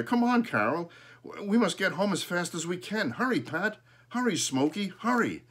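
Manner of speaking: high, loud urgent calls; urgent hurrying. A narrator's reading. Boy hurries sister and pets home, high urgent calls. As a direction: rush them all home.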